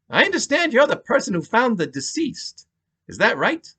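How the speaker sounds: high-pitched New York detective; calm New York cop; New York accent. Acted. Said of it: "thick New York accent".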